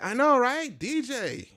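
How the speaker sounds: high, loud hype call to the DJ; hype shout. Real, not acted. Streamer hyping the DJ, high and loud. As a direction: whoop it up like at a club.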